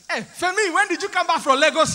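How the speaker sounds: loud, high West African shout across a train; shouted conversation. Acted. Nigerian man hailing his friend across the train, shouting. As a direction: holler a greeting to a friend far off. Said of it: "looking like we're shouting".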